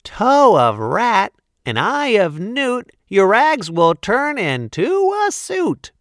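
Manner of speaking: high chanted fairy spell; tiny tinkling fairy voice. Acted. Fairy casts another spell.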